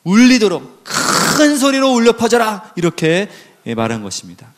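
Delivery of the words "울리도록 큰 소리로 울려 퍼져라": higher, drawn-out ringing call; stretched, raised preacher's cry; resounding proclamation. Acted. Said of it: "크게 외치고 울려 퍼져서".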